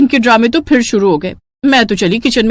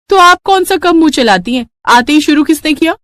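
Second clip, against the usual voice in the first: sass her mother-in-law sweetly; bright mock-innocent retort; cheeky daughter-in-law; 7 semitones higher than usual. Bahu snaps back.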